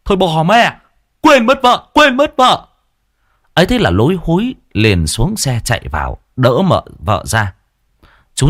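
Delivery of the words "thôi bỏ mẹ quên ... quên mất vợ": high, loud panicked cry; husband realising he forgot his wife; startled outburst. Acted. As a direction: yelp in alarm at forgetting her. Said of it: "giật mình".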